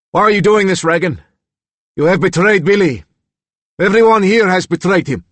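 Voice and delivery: loud, high bitter accusation; angry accusation. Acted. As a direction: accuse them all. Reagan accuses the staff.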